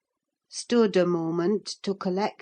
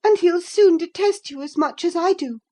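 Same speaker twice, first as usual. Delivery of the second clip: high, loud defiance; defiant girl; 10 semitones higher, 6 dB louder than usual. A narrator's reading. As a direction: shout her defiance. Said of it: "said Catherine".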